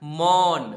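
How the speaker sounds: मौन called out loud to show its vowel; teacher calling out मौन to show the a sound; loud sound demo. Shown as a demonstration. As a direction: sound out मौन loudly for the class. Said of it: "म में अ का sound आ रहा है".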